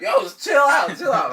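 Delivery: high, loud panicky plea; pleading to calm down. Retold or imitated. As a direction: yell at him to chill out. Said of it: "and Josh is just like".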